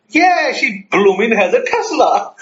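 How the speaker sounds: high, drawn-out Cockney exclamation; playful put-on London accent. Friend confirming she has a Tesla, London accent. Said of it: "there's a London accent now".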